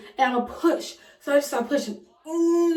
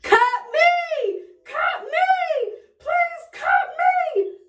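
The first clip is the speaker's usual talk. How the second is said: screaming; much slower than usual. Retold or imitated. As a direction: shriek it at the doctors, begging. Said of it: "I'm screaming".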